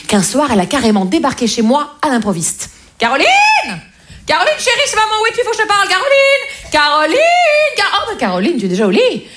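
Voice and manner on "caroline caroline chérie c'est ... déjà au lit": calling mother. Retold or imitated. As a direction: call out like a frantic mother.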